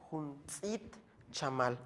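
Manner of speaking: Huntzit chamal said slowly, syllable by syllable; slow Maya model. Shown as a demonstration. Teacher models Huntzit chamal slowly for students. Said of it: "teacher models Maya word".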